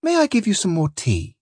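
high, unruffled hostess voice; placid wife. A narrator's reading. Captain's wife offering tea after his outburst, placid. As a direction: offer it sweetly, as if nothing happened.

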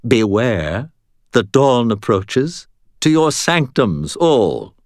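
high, grandly scornful command; contemptuous. A narrator's reading. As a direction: dismiss your underlings with lofty contempt. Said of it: "her tone full of contempt".

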